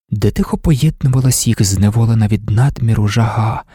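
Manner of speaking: plain reading voice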